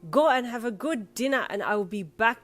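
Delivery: insistent cab driver. A narrator's reading. Tony sending passengers off to dinner, insistent. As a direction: insist forcefully, as a friendly order.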